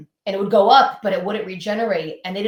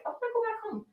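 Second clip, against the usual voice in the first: high, soft whine, much higher and softer; whiny homesick voice; 10 dB quieter, much faster than usual. Retold or imitated. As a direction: whimper it like a homesick kid. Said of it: "I'm like".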